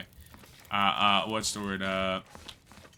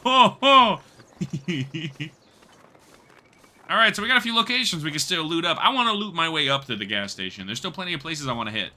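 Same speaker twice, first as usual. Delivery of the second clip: ordinary talk, nothing marked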